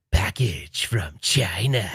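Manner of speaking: slow, drawn-out catchphrase; impression of friend. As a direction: stretch it out slowly like his catchphrase. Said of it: "He has to come on and be like".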